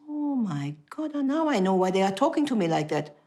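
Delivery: high, stunned disbelief; shocked realization. Retold or imitated. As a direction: gasp it out as the truth dawns. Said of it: "I say".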